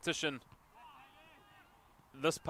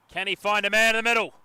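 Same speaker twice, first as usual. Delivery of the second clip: loud, raised-pitch urgency; excited match call; 6 semitones higher, 12 dB louder than usual. Real, not acted. Commentator follows North Rocks attacking, excited. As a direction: build anticipation, voice lifted and loud.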